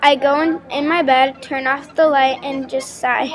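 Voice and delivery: usual speaking voice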